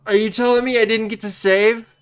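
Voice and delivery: ordinary delivery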